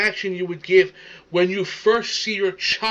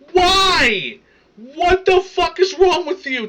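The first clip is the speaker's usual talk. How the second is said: high-pitched, loud, incredulous yell; exasperated outburst; 9 semitones higher, 5 dB louder, slower than usual. Real, not acted. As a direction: explode in outraged disbelief. Outraged reaction to crying video.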